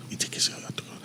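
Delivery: soft whisper, barely voiced; hushed test phrase; whispered demo. Shown as a demonstration. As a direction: whisper it into the mic. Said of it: "let me try and talk slowly".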